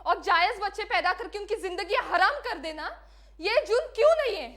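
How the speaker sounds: high, loud accusing questions; impassioned challenge. Acted. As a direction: hurl the question at the world. Condemned woman challenges the world. (ऊँची आवाज़ में जोशीला सवाल)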